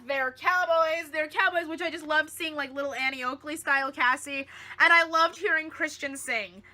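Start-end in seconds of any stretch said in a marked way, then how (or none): none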